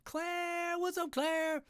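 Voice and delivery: bright high-pitched welcome to a newcomer; excited greeting. Real, not acted. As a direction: call out happily to a friend joining. Greeting a viewer arriving.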